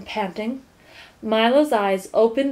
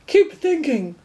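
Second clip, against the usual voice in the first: sharp higher scold; 6 semitones higher, 3 dB louder than usual. A narrator's reading. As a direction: tell him off to keep going. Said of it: "scolded the watchdog".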